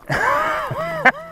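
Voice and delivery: high-pitched giggling burst; laughter. Real, not acted. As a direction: crack up after fumbling the line. Cracking up mid-take.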